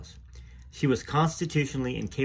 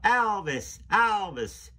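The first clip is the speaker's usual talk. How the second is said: high called-out Southern fan cry; Southern drawl calling a name; Southern accent; 10 semitones higher, much slower than usual. Retold or imitated. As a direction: mimic fans shouting in Southern accent. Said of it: "in their southern accents".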